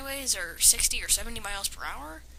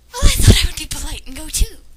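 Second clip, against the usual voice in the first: sarcastic daughter; 7 semitones higher, 15 dB louder than usual. Acted. Sassy daughter's reply to mother.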